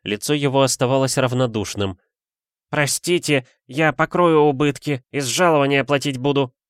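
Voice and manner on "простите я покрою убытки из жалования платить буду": higher-pitched, guilty servant's voice; apologetic. A narrator's reading. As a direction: plead sheepishly to make amends. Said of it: "виновато пробормотал Фирс". (виновато, высоким голосом)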